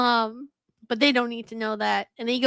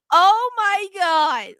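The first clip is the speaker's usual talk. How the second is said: slow, higher, louder sarcastic gasp; mock outrage; 7 semitones higher, 6 dB louder, much slower than usual. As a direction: drag it out mocking the fans. Mocking fans' tantrum.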